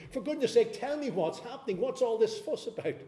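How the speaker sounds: urgent plea. Acted. Blind man pleading for information.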